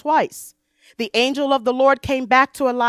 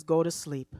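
gentle command; 7 semitones lower, 8 dB quieter than usual. Acted. God's gentle instruction voiced.

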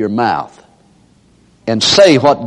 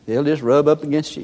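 ordinary talk, nothing marked

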